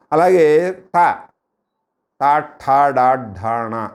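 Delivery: very slow, tongue tip struck on the roof; retroflex letters demo. Shown as a demonstration. Teacher demonstrating retroflex letters slowly for students. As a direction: hit each retroflex ట slowly and clearly. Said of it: "నాలుకెళ్ళి పైన మూర్ధన్యాన్ని కొట్టుకుంటుంది".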